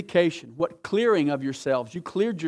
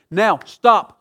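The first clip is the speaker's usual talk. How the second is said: loud, higher now stop; commanding stop; 6 semitones higher, 13 dB louder than usual. Real, not acted. Preacher stopping the congregation, loud. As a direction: halt the room sharply.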